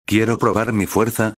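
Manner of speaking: usual speaking voice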